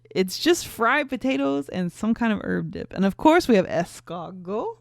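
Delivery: loud, patchy mock-French accent; horrible French accent. Woman describing dishes to boyfriend, bad French accent. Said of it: "my horrible French accent".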